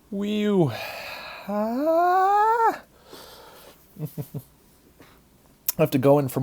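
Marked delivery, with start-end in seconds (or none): 0.1-0.7 high pained whoop. Real, not acted. Burning pain from hot nut. 1.5-2.8 high pained cry. Real, not acted. Burning pain from hot nut.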